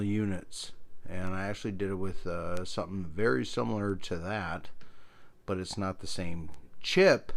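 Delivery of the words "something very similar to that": usual speaking voice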